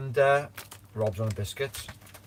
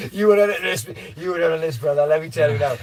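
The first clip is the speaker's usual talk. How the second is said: loud, higher, laughing as it starts; laughing insistence; 6 semitones higher, 9 dB louder than usual. Real, not acted. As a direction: rope a mate in, amused. Teasingly insisting friend joins hike.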